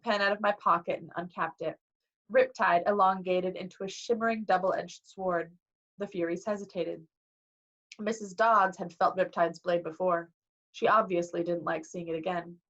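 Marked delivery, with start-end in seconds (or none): none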